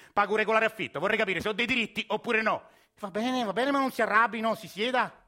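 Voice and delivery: man insisting on his rights at the police station; heated. Acted. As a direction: push back hard against the officer. Heated exchange at police desk.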